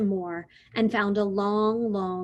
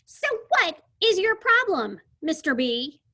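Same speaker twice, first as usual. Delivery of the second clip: put-on bossy girl; 9 semitones higher, 5 dB louder than usual. Lulu bossy.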